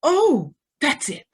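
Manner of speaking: it clicks all at once; remembering the bomb with a start; sudden realisation. Acted.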